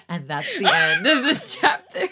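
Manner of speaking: loud laughing words. Real, not acted. Still laughing after cliffhanger.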